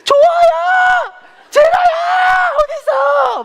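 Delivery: long, very high screamed names; imitating a panicked grandmother, stretched and loud; calling lost children. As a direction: shriek for the missing kids. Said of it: "애들 이름 막 부르기 시작해".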